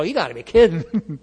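scoffing, loud disbelief; loud, mocking incredulity; incredulous disciples.

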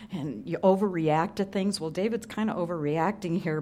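ordinary talk

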